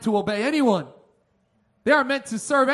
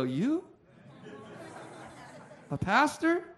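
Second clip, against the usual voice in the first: soft rising questions with pauses between; judging disbelief; 7 dB quieter, slower than usual. Retold or imitated. People hearing he is a pastor, mock incredulous. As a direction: imitate someone doubting in stages, each phrase lifting. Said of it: "They look at me and they judge me".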